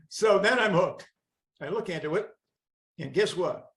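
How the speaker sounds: usual speaking voice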